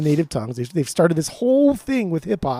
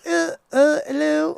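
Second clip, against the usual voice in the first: much higher, put-on squeaky imitation; mock high-pitched voice; 12 semitones higher than usual. Mimicking Oscar's uneasy accent attempt, high-pitched. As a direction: imitate him way up high, hesitantly. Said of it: "He's really high pitched".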